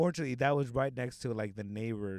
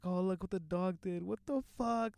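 higher-pitched mock crying; imitated crying; 7 semitones higher than usual. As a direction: wail it like an upset sister, imitating her. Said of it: "Like, crying".

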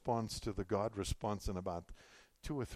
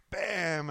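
loud, high "bam"; sudden loud impact word in a retelling; imitated crash; 9 semitones higher, 5 dB louder than usual. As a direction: punch out the crash noise. Acts out the crash.

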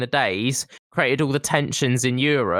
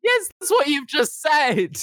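incredulous; 13 semitones higher than usual. Real, not acted. Mocking friend's claim.

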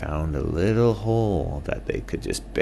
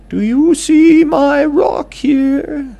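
high, loud owl character; squeaky owl friend; 12 dB louder, slower than usual. Acted.

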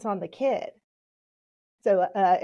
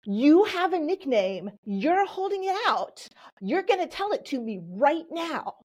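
mock yell, pitched way up; 8 semitones higher than usual. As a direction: act out an exasperated teacher's demand. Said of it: "she yelled out".

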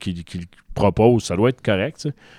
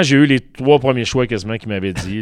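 ordinary talk

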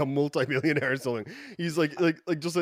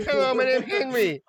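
high-pitched mock Vietnamese-accented greeting; caricatured Vietnamese accent, pitched well up; imitated Vietnamese accent; 10 semitones higher, 4 dB louder than usual. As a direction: cheerily introduce yourself in a put-on Vietnamese accent. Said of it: "like, thick Vietnamese accent".

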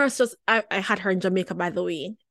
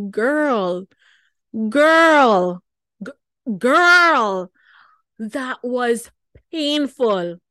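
reliving the pain; 4 semitones higher, 7 dB louder, much slower than usual. Retold or imitated. Reliving the doctor's painful swoop.

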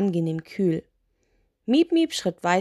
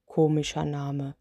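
lower, softer giant, lightly voiced; yawning giant; 4 semitones lower, 4 dB quieter than usual. Sleepy spirit on the witch's name, low and soft. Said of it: "sagte der Mann und gähnte".

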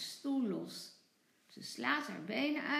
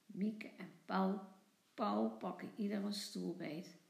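ordinary delivery, nothing marked

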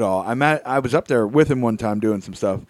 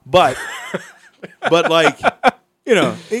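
ordinary talk

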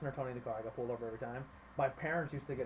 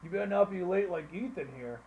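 louder, higher put-on coach voice; [name]'s coach voice; 5 semitones higher, 6 dB louder than usual. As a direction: imitate a worked-up football coach calling out a player. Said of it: "that was [name]'s voice about every coach".

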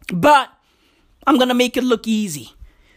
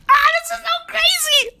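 thrilled high exclaim. Real, not acted. Delighted by bird.

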